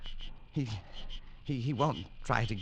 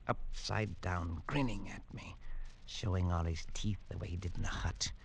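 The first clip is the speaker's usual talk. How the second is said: soft, hushed whisper of the memory; hushed dread recalling the grinning face; haunted whisper; 3 semitones lower, 7 dB quieter than usual. Acted. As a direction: whisper it as if it still frightens him. Recalling a haunting nightmare image.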